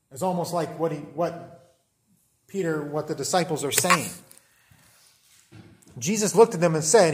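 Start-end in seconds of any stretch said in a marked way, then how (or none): none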